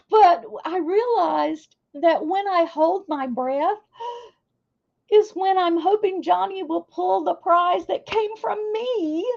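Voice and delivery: real teary laugh through the words; still teary. Real, not acted. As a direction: keep talking through lingering tears. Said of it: "Y'all making me cry".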